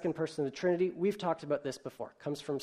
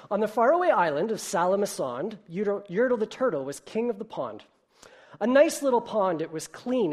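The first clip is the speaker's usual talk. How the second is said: bouncy, higher and louder read-aloud; lively storybook reading; 4 semitones higher, 5 dB louder than usual. A narrator's reading. Preacher reading Dr. Seuss to the congregation, bouncy and animated. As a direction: read it like a bedtime story for kids. Reads Yertle the Turtle aloud.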